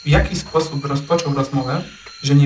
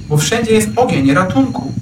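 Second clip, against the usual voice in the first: higher, urgent cry for help; panicked cry; 6 semitones higher than usual. A narrator's reading. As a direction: panic in every word. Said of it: "Jelenie były w takiej panice". (wyższy, spanikowany krzyk o ratunek)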